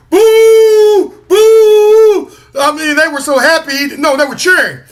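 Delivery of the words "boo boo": loud, high crowd boo; mock booing. Imitating fans booing from the stands, loud and high. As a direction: boo like a hostile stadium crowd.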